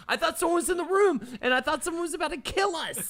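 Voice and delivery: high, fast panicked retelling; frightened. Retold or imitated. Recounting waking up sure someone was in the room, panicked. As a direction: blurt it out in comic panic. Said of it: "I have not been so frightened".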